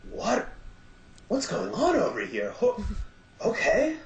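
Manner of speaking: high, puzzled what-is-this voice; baffled surprise. Retold or imitated. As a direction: play it as walking in on something strange. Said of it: "I kind of walk in and was like".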